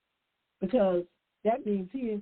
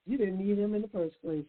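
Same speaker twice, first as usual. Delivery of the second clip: ordinary talk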